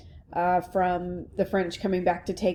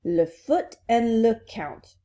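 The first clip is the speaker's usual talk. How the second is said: put-on slow, louder French-accented echo; 4 semitones higher, 4 dB louder, slower than usual. Princess repeating the new words, French accent. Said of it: "hear this in a French accent".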